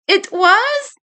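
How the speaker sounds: light up with delight; joyful surprise. Acted. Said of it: "Eddie burst with joy".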